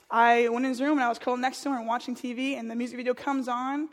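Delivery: ordinary talk, nothing marked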